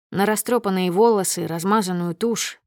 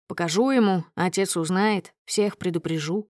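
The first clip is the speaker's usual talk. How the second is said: ordinary delivery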